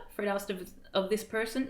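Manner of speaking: plain reading voice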